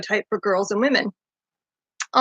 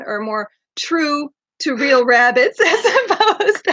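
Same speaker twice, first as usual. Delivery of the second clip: laughing; 3 semitones higher than usual. Real, not acted. Laughing at herself.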